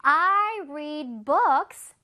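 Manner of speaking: I read books at the library, every word drawn out; slow example sentence. Shown as a demonstration. Teacher reading an example sentence slowly to kids. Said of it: "예문에서 볼게요".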